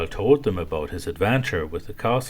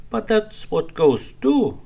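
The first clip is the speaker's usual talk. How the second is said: little ghost voice; 13 semitones higher, 6 dB louder than usual. Acted. Ghost replies to hippo.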